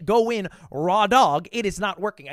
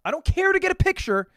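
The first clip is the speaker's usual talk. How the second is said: emphatic protest; 11 semitones higher, 4 dB louder than usual. Real, not acted. Insisting he never asks.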